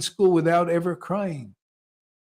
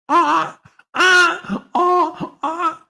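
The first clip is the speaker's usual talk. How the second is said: high, loud squawking chicken noises; chicken clucking; 8 dB louder, much slower than usual. Retold or imitated. Mocking dumbfounded disciples as clucking chickens, loud high squawks. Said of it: "Like a chicken".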